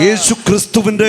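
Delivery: high, loud declaratory cry; full-force prayer. Real, not acted. Preacher leads congregation line by line, loud fervent proclamation. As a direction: call it out strongly for the crowd to echo.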